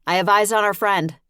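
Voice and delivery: loud and high, urgent; calling out. Acted. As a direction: call it out across the stalls. Calling out to partner.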